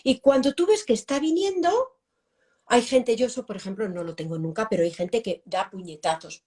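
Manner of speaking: usual speaking voice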